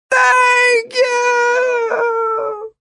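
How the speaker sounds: very high, drawn-out scream; wailing yell stretched out; long falling shout. A narrator's reading. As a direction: scream it while plummeting away. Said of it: "Martin shouted".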